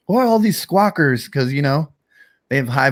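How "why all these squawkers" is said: higher-pitched mock complaint; imitated griping. Imitating friend griping about kids.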